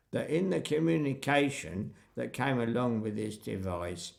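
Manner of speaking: deferential West Country countryman doing his duty; measured West Country burr; West Country accent. Retold or imitated. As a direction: imitate a solemn West Country local. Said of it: "Afraid I'm using a West Country accent".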